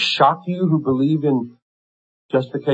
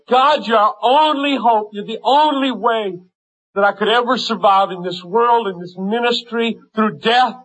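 fervent preaching; 9 semitones higher than usual. Acted. Preaching at full force.

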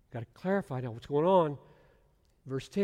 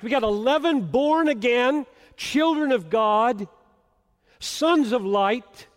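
higher, louder preaching at full force; fervent preaching; 9 semitones higher, 11 dB louder than usual. Real, not acted. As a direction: drive every phrase home from the pulpit.

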